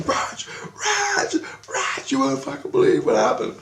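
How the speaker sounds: very high-pitched, breathless-style mock excitement; excited friend. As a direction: imitate a friend bursting in with huge news. Friend runs up with urgent news.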